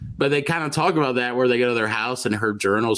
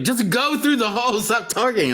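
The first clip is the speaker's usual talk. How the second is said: high-pitched impatient mock plea; exasperated urging; 11 semitones higher than usual. Viewer to dawdling movie heroes, exasperated urging. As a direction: push them to hurry, voice pitched way up.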